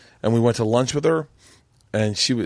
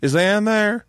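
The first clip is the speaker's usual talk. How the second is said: high-pitched Southern mother-in-law; Southern accent; 10 semitones higher, 3 dB louder, much slower than usual. Retold or imitated. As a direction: imitate a Southern lady on the phone, pitched up. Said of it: "I heard the accent".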